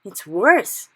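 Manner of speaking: loud emphatic cry; cried. Acted. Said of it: "cried the B F G".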